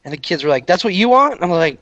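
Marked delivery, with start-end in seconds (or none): 0.7-1.3 higher questioning call; imitated call back. Kids in the car checking the order, calling back. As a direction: pitch the question up to carry.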